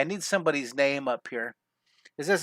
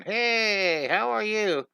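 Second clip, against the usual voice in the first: higher, chirpy cartoon greeting; friendly cartoon character; 6 semitones higher, much slower than usual. Little character greets another, lightly voiced and cheery. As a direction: pop up cheerfully with a hello. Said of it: "this character going".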